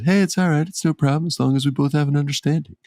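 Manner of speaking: high-pitched, hurried wrestling-boss impression; Triple H impression. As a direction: put on a high voice and reassure quickly. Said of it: "here comes my Triple H impression".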